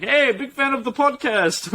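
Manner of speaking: high, drawn-out bad New Zealand accent; attempted New Zealand accent. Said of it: "don't even know if that's what New Zealand people sound like".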